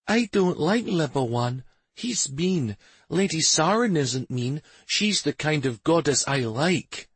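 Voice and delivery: lightly voiced earnest boy; child voice. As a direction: speak like a serious little boy. Young son talks about gods.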